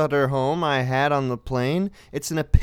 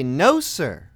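seething; 6 semitones higher than usual. Retold or imitated. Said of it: "I'm seething".

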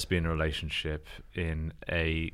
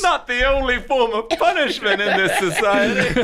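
high, loud shrieky prisoner voice; panicked high voice; 7 dB louder than usual. Pretend. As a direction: keep shrieking, barely holding a laugh. Continues high shrieking voice, laughing.

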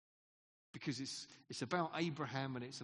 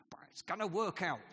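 a light hint of the director's blind optimism, a bit louder; breezy confidence; 3 semitones higher, 5 dB louder than usual. Theatre director shrugging off trouble, mildly confident. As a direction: toss it off as if it will surely be fine. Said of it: "he's always expressing confidence".

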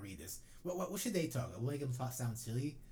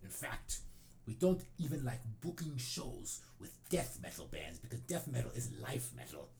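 fake Norwegian accent. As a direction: drag it out like a fake-Norwegian metal purist. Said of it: "make a fake Norwegian accent".

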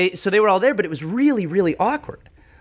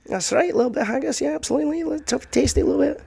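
put-on high-pitched caricature of a Scottish accent; mock Scottish accent; 10 semitones higher, faster than usual. Scot going on about haggis, mock Scottish accent. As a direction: play a hammed-up Scot, voice well up. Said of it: "That's not a Scottish accent".

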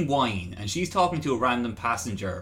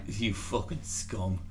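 mock disgust; 5 semitones lower, 10 dB quieter, slower than usual. Mock outrage, slow and quiet.

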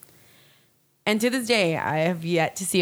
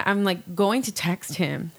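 normal talking voice

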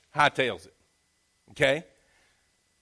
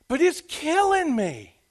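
whiny mock complaint; 12 semitones higher, slower than usual. Shown as a demonstration. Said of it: "Probably not".

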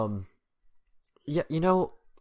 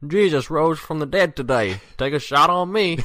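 loud, high hammed-up bar promo; mock bar promo; 7 semitones higher, 10 dB louder than usual. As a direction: sell Easter shots like a cheesy ad.

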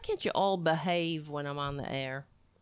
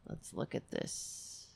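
normal talking voice

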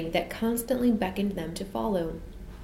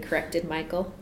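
usual speaking voice

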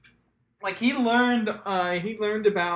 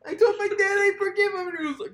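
very high cartoon voice; squeaky mock caller; 13 semitones higher, 3 dB louder than usual. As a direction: squeak it like a giddy teen. Mocking caller voice.

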